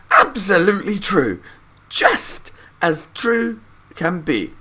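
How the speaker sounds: put-on high, loud and slow old voices; old people agreeing. A narrator's reading. Three grandparents agreeing with Grandpa Joe, high slow old voices. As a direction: nod along like eager old folks. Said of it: "and said".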